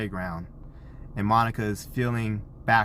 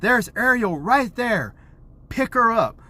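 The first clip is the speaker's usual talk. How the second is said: acted urgent excitement; 10 semitones higher, 5 dB louder than usual. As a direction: play up spotting someone in need of rescue. Dad acts out spotting Ariel.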